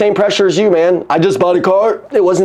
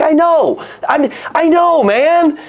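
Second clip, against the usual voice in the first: high, eager I know; eager agreement; 9 semitones higher, slower than usual. Retold or imitated. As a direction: jump in with relief, pitched way up.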